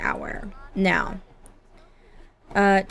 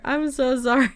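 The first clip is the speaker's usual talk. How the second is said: laughing apology; 4 semitones higher than usual. Real, not acted. Player apologising to her Sim while cracking up laughing.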